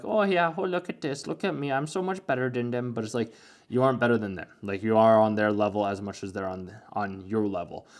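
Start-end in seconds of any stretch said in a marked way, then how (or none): 0.0-2.9 high mocking show-off voice; smug mocking. Mocking the smug girl who feels superior, high sneer. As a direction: sneer it like a gloating brat. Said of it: "smile and walk away like that".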